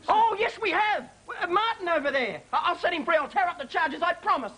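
high, excited reassurance; eager backtracking. Acted. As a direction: scramble to save the deal. Eagerly reverses himself to keep chateau.

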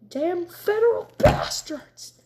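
slow, hissed angry whisper; angry outburst. A narrator's reading. Father seeing his burned farm, hissing in rage. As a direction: spit the curse through your teeth. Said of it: "Tobias exploded angrily".

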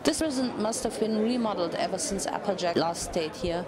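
ordinary delivery

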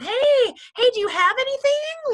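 mock frantic director chasing numbers; desperate ask. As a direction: beg for sales at month's end. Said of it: "let them know the last week".

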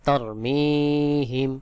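তরমিহিম with a long-held মি; slow recited তরমিহিম, drawn out on মি; elongated pronunciation. Shown as a demonstration. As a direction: stretch the ee in the middle of তরমিহিম, then close on him. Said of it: "মিম ইয়া জের মি এক আলিফ টান".

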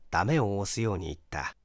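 plain reading voice

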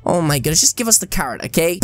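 normal talking voice

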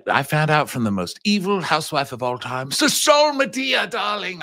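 high theatrical diva voice; campy diva. Acted. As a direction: play the grand housewife diva.

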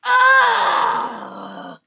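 high, loud cry of frustration; loud exasperated ugh; frustrated scream. Real, not acted. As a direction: scream out at the failure. Failing at a game.

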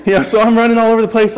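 fast, laughing through the words; giggling retelling, fast. Real, not acted. Laughing at own story.

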